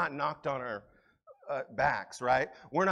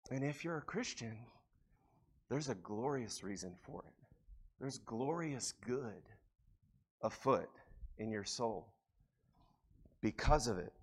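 much softer, lower, slowed comfort; hushed reassurance; 6 semitones lower, 11 dB quieter than usual. Real, not acted. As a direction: lean in and comfort the grieving. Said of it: "hushed tender reassurance".

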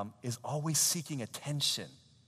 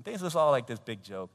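usual speaking voice

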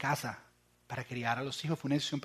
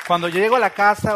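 emphatic shout; 5 semitones higher, 13 dB louder than usual. Real, not acted. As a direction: burst out loud to stress home. Exclamación enfática del predicador. (gritando con énfasis)

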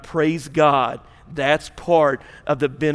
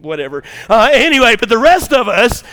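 normal talking voice

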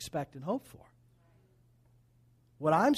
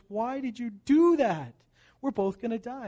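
storyteller's betrayed frog, raised voice; dismayed frog; 5 semitones higher, 4 dB louder than usual. Acted. Stung frog protests in fable.

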